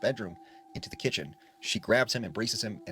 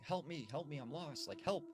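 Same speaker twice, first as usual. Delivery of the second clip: higher-pitched, held-back imitation of screaming; retold scream; 5 semitones higher, 10 dB quieter than usual. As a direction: call out for help at storytelling volume. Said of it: "So he starts screaming".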